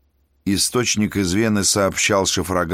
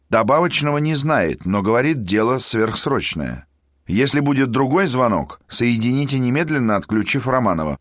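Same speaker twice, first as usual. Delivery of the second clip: higher, brisk order; commanding prince; 6 semitones higher than usual. Acted. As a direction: give the order curtly. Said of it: "велел князь".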